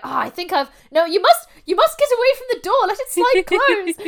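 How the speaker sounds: a fussy little man bustling someone away from the door; hammed-up, fretful Doctor shooing Hobson out, pitched high. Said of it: "Fussy little man".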